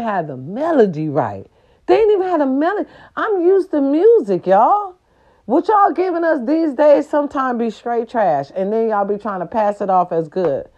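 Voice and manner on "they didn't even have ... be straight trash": exasperated rant. Real, not acted. Ranting about bad remake.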